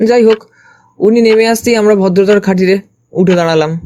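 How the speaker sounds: ordinary delivery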